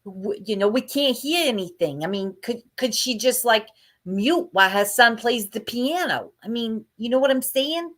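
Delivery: high-pitched complainer imitation; Karen. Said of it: "Karen would be like".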